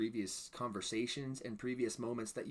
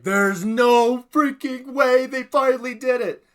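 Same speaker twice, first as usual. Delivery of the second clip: high, very loud, drawn-out shout; disbelieving excited yell; 18 dB louder than usual. Retold or imitated. Reenacting first reaction to the reveal.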